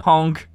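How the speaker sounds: playful honking noise; silly clown honk. Acted.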